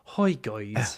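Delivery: half-whispered Call Me Kevin impression. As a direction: greet viewers in a low, airy put-on voice. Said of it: "try doing a Call Me Kevin voice".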